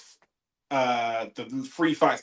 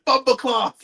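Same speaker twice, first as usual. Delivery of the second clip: high loud Jamaican exclamation; mock Jamaican curse, loud and high; Jamaican swear; 11 semitones higher, 7 dB louder, slower than usual. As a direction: blurt it out in Jamaican patois. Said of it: "she just looks at the camera and goes".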